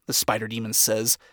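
plain reading voice

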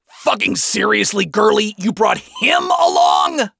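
high-pitched exasperated yelling; frustrated yell. A narrator's reading. Adam yells on spotting Lucifer, frustrated. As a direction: yell it out, rising in disbelief. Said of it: "Adam yelled in frustration".

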